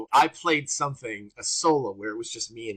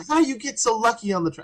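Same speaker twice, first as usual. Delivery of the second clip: higher, louder teasing mock; loud crazy Gary; 11 semitones higher, 5 dB louder than usual. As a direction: rib your friend loudly. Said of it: "to be loud and crazy and funny".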